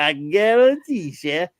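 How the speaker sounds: slow, drawn-out Cajun "I guarantee"; Cajun impression. Shown as a demonstration. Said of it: "He said, he said".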